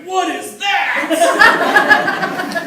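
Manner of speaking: put-on very high, slow, drawn-out girl's voice, laughing; curious daughter. Dad voices his daughter at the birth, high and amazed. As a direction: stretch it out like a wide-eyed kid.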